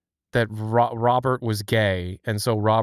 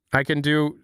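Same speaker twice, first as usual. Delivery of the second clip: ordinary talk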